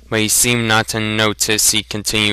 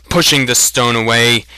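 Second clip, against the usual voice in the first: ordinary delivery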